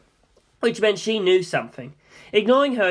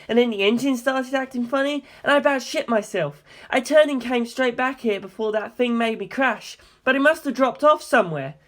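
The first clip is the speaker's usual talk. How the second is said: rattled pilot; 7 semitones higher, 4 dB louder than usual. Acted. Jim describes the monster, agitated.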